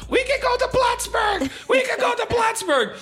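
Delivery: very high, drawn-out excited shout; imitated mom screaming. Imitating mom screaming border news to neighbor, excited shouting. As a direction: holler it like an overjoyed mom. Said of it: "my mom on the phone screaming to our neighbor".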